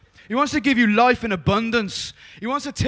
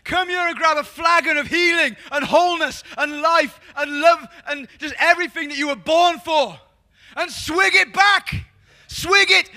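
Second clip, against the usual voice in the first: higher, louder, rousing preacher's call; fiery preaching; 6 semitones higher, 6 dB louder than usual. Acted. Preacher urging the congregation to come and drink, loud and rousing. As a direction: whip the crowd up, shout the invitation.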